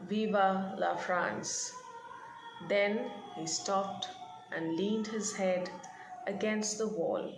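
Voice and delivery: reading the passage aloud, gently paced; measured read-aloud narration; story reading. A narrator's reading. Teacher reads story passage aloud.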